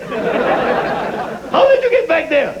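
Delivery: high, squeaky surprised mock Arabic accent, slow; mock Arabic accent, startled; mock Iraqi accent. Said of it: "Iraqi accent".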